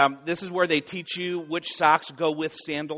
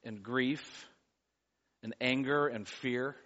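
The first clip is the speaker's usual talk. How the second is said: soft, low, hushed prayer voice; 8 semitones lower, 14 dB quieter than usual. Acted. Pastor praying softly for a grieving congregation, hushed prayer. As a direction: pray quietly and earnestly over the people.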